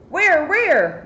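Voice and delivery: loud, high panicked question; yelling. Acted. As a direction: shout it, looking around in alarm. Character yells back in story.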